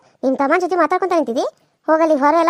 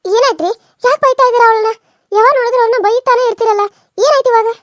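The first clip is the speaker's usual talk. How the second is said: higher and louder, demanding answers; raised protesting voice; 6 semitones higher, 3 dB louder than usual. Acted. Aunt rushing in to challenge the husband, loud and high. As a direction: storm in and challenge the scolding.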